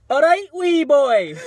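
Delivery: high, slow mock Scottish greeting; Scottish accent, hammed up. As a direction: drawl it out in a put-on Scottish accent. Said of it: "a Scottish impression?".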